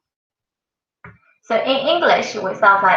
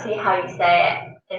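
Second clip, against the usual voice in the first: ordinary talk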